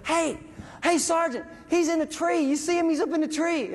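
put-on high excited young soldier voice; Floyd calling out. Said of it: "And Floyd's like".

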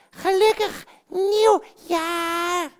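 high, slow, drawn-out ghost wail; a hint of a ghost's wail; ghostly voice. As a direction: moan the greeting like a spook.

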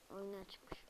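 whispered aside to the viewers. Real, not acted. Whispering to viewers that a teammate quit. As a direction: drop to a whisper to share it.

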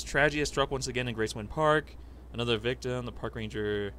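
subdued read-aloud, fading at the end; newspaper reading. Acted. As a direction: read the bad news out quietly. Reads game newspaper aloud.

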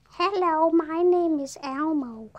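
chirpy little red monster voice; Elmo voice. Acted. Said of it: "that was the worst Elmo voice ever".

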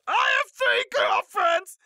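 very high, squealing mock yell; mocking tantrum shout. Reader mocking the poster's reminders about his girlfriends, squealing imitation. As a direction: parody him bragging at full squeal. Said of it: "Love how he keeps reminding us that".

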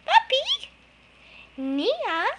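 very high questioning call; squeaky praising a dog. Real, not acted. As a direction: call the puppy sweetly.

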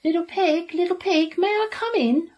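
high, loud singsong wolf call; calling at the door. A narrator's reading. Friendly wolf at the little pig's house, calling out.

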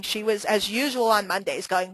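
ordinary talk

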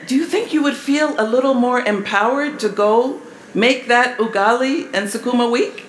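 usual speaking voice

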